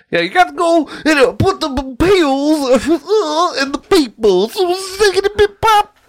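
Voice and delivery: high, fast squeaky cartoon voice; cartoon game voice. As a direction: squeak it like a video game character. Imitating Doctor Mario game voice.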